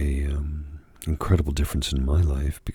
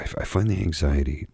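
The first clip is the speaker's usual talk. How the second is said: ordinary talk, nothing marked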